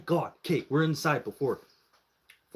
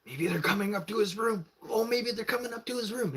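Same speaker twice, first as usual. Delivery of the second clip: high-pitched giddy imitation; put-on voice, high with anticipation; 9 semitones higher than usual. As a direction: squeal it like gossiping friends. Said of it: "we're, like, all giddy now".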